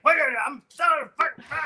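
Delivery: high, fast, loud mock yell; angry dad shout. Dad grabs the boy's arm, mock furious yell. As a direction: bark it at the kid, furious. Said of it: "He's like".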